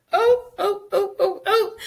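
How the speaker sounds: string of high, short oohs; high hooting "oh"s. Retold or imitated. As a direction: hoot in delighted shock, high-pitched. Retold reaction to best line.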